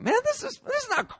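mock teen complaint; upset teen. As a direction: whine like a fed-up kid. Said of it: "He's all upset".

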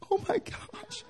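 very high, airy squeal of amazement; amazed old lady. Retold or imitated. Old lady healed at the altar, squealing amazed. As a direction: gasp like a delighted old lady. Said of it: "Old ladies are like".

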